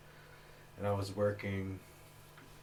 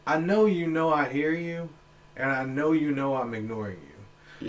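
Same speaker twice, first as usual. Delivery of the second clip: high, loud acted telling-off; exasperated loud retort; 6 semitones higher, 12 dB louder than usual. Acting his answer to the yelling stranger he ignores, loud exasperation. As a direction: tell off a pest at full volume.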